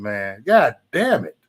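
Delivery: normal talking voice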